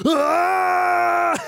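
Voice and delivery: high, drawn-out imitated yell; mock outburst scream. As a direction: erupt in a sudden high-pitched mock scream. Imitating film gangster's sudden outburst.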